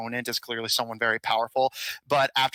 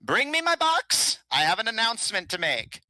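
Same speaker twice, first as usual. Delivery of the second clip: put-on frail old king; 10 semitones higher than usual. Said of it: "and says".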